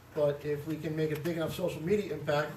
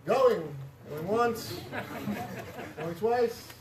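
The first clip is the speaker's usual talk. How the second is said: mock auctioneer; 4 semitones higher, much slower than usual. Mock auction countdown on the floor.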